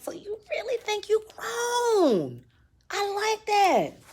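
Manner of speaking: high, slow, drawn-out menace; mock-sweet mama. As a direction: stretch it out sweetly, as a veiled threat. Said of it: "So my mama's like".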